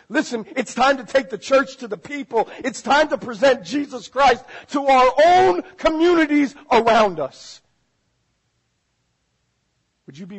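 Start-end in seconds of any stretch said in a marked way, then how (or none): 0.1-7.6 rising, building sermon exhortation; preaching at full force. Real, not acted. Preacher urging the church out to the people, building in force. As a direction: build to a loud, high call to action.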